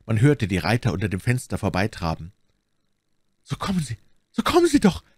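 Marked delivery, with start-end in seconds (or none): 3.4-5.0 high, building to a loud shriek; urgent call. A narrator's reading. Milady tugs Bonacieux by the arm, urgent call. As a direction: urge them on, rising to a cry. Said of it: "rief My Lady".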